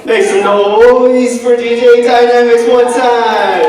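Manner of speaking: big hype shout to the crowd; rally speech. Real, not acted. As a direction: yell it out like a hype man. MC hyping the crowd.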